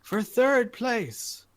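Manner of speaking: high, slow prize announcement; arena announcer. Acted. Announcer reading third prize to the arena, drawn-out showman. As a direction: stretch out the placing and the prize. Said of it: "he says as the audience".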